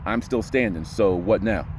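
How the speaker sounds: low, settled, certain; deep confident voice. Shown as a demonstration. Coach showing a deep confident tone. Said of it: "You see how I, I, and that just instinctively".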